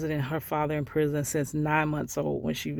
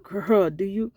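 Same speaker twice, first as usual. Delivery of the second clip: ordinary talk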